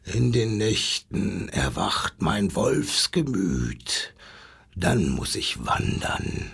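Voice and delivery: put-on deep, slow growl of a stubborn old wolf. A narrator's reading. Old wolf grumbling back at the zookeeper, deep slow growl. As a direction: rumble it low like a grey wolf refusing. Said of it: "knurrte der alte Graue".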